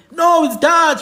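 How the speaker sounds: no, it's Dutch, insisting hard; emphatic retort. Retold or imitated.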